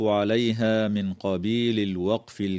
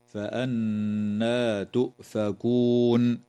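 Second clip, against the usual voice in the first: slow drawn-out recitation of فأنى تؤفكون; much slower than usual. Shown as a demonstration. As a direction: draw out the نّ of فأنى with ghunna. Repeats verse to mark end.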